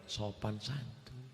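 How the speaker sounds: preacher softening his booming voice on purpose; softened. Shown as a demonstration. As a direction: say it gently, as promised. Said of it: "kita perlembut".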